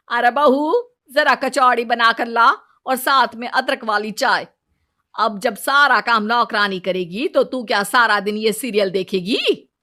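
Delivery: mother-in-law snapping at the maid then ordering the bride; bossy mother-in-law. Acted.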